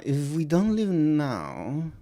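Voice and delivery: slow, drawn-out German accent. Shown as a demonstration. Working out the accent with a friend, German. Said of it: "gotta say, like, a V".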